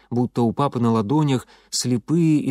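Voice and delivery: ordinary delivery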